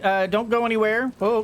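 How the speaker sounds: usual speaking voice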